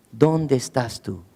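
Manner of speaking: tender searching call; a calm, unhurried plea; gentle, slow, soft question. Acted. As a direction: ask it softly and slowly, without anger. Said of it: "no llega con una actitud de enojo".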